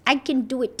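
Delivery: put-on small child's proud little voice; baby voice. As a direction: say it like a tiny stubborn toddler. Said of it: "in her little baby voice".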